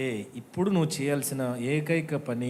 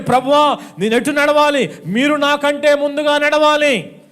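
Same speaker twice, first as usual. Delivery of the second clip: loud, higher fervent prayer; prayer to the Lord; 10 semitones higher, 11 dB louder than usual. Retold or imitated. Believers crying out to the Lord, loud fervent prayer. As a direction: cry out to the Lord to lead. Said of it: "అని ప్రభువుని".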